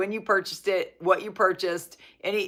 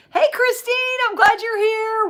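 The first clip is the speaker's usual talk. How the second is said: much higher, bright welcome; excited greeting; 5 dB louder than usual. Real, not acted. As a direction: squeal a happy hello. Greeting a viewer arriving.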